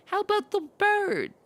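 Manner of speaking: high, sudden goblin; high chirpy goblin. Acted. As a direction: goblin butting in. Goblin chimes in.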